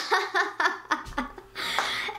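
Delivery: breathy acted chuckling; imitated laugh. Mate laughs on hearing who is meant, imitated knowing laugh.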